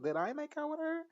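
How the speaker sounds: put-on high, confused drunk squeak; drunk girl voice. Recapping a show, the drunk girl asking up high. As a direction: ask it up high, lost and tipsy.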